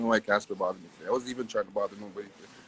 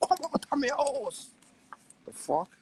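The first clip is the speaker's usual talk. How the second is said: high, hissed order to leave as a father; whispered high-pitched get out as the dad; mock outraged father. Father throws his son out of the house, high whisper. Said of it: "My son can't go home and say".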